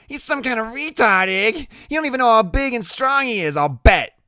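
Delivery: very high, loud character voice; Loretta, teasing. Acted. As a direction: needle him in Loretta's high voice. Loretta mocking and egging on.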